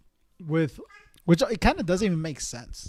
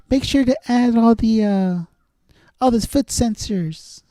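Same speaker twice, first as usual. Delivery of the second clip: [name] voice; 7 semitones higher than usual. Retold or imitated. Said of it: "he's like".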